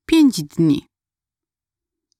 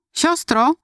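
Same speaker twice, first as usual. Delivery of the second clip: „Siostro” called higher and more boldly; bolder call; 9 semitones higher than usual. A narrator's reading. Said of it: "powtórzyłam trochę śmielej". (śmielsze, wyższe wołanie „Siostro”)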